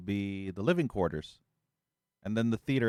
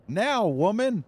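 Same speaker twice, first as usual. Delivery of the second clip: hammed-up bellow, much higher and louder; mock shouted order; 11 semitones higher, 6 dB louder, slower than usual. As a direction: yell the order like a cartoon tyrant. Husband barks at wife.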